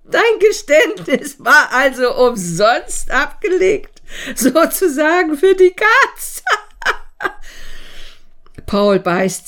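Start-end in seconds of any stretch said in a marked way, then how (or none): 0.0-6.4 triumphant gloating. Acted. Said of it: "prustet er triumphierend". 6.8-8.1 breathy spluttering laugh; triumphant snorting laugh. Acted. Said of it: "prustet er triumphierend".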